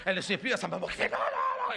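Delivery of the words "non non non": much higher pleading no; mother of the bride begging no; frantic no. Acted. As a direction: squeal the no's like a horrified mother. Mother mouthing no.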